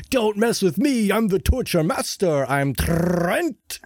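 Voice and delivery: lightly voiced wannabe tough guy; a hint of a bad bro act; fake tough bro. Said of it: "He's, he's like".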